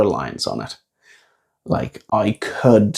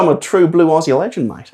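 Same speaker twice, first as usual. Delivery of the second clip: higher-pitched, exaggerated Australian accent; Aussie accent; 7 semitones higher than usual. Shown as a demonstration. Joking with improv colleague about extreme accents, Aussie accent. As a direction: put on a broad Aussie accent. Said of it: "is a bloody Aussie accent".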